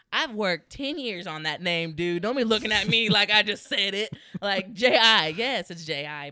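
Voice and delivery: loud, insistent comic retort; mock indignation. Comedian defending a stage name to a doubter, indignant. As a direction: play up being offended about your name.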